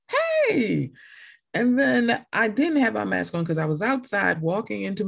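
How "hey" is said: very high, retold hello; awkward greeting. Masked shopper answering someone who recognized her, high awkward greeting. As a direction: pitch the hello way up, at normal volume.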